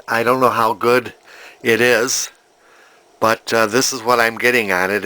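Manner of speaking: normal talking voice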